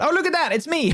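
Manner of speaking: high, bright squeal of recognition; oh look, that's me; delighted surprise. Real, not acted. Streamer spotting herself in fan art, surprised.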